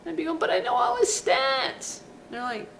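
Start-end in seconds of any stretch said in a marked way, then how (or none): 0.4-2.0 high, loud whiny protest. Retold or imitated. Fan whining at the butler, high and loud. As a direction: squeal the excuse.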